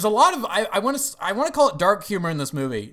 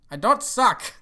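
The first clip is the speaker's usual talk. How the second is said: slightly hammed-up, higher-pitched retort; mock indignant retort; 6 semitones higher, 4 dB louder, slower than usual. Assistant answering boss's insult, mock indignant. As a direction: imitate a wounded assistant protesting.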